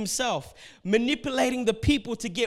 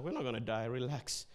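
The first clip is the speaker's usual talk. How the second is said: much lower and softer, unbothered; calm and cool; 7 semitones lower, 10 dB quieter than usual. Retold or imitated. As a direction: settle them down without any alarm. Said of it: "he's cool in every circumstance".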